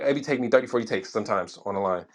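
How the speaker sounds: ordinary delivery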